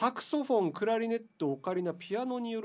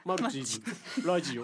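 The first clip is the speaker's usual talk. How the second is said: mock furtive mumble. Host rehearsing the password for the reception desk, mock furtive. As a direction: hesitantly drop the secret password. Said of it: "受付に行っておもむろに".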